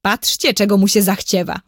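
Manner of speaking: usual speaking voice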